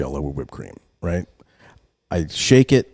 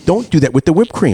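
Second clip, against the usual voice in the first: retold scolding; 9 semitones higher, 5 dB louder than usual. Parent scolding son, acted out.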